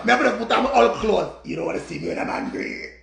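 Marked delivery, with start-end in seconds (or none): 1.5-2.9 far lower, whispered menace; hushed Hulk-style warning; menacing low voice. Pretend. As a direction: growl a mock threat from deep down. Threatening angry-self voice.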